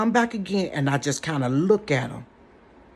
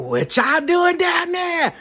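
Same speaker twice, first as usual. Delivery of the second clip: high, slow, loud lurking call; creepy call; 12 semitones higher, 5 dB louder, slower than usual. Retold or imitated. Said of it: "on some creepy junk".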